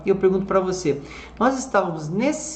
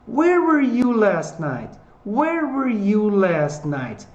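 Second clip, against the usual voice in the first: "Where" high and drawn out, gliding down to "night"; stress on WHERE; 4 semitones higher, much slower than usual. Shown as a demonstration. Teacher asks about last night, stress on "Where". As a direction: hit "Where" high, slowly descend. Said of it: "a entonação tá nas palavrinhas com W H".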